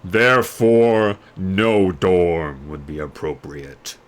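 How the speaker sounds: an ancient mirror delivering a verdict; slow solemn magic mirror; oracle mirror voice. Acted. Mirror's final ruling.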